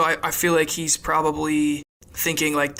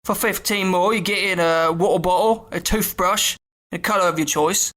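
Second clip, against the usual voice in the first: high, singsong Cockney conductor; chirpy conductor listing prices; same Jim Dale tone; 5 semitones higher than usual. Retold or imitated. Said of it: "in that same tone".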